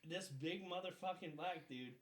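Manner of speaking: ordinary talk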